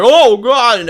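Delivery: high, loud yelp of alarm; panicked cry. Acted. Haggard man scared by shots over his head, panicked cry. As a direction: cry out as if just shot at.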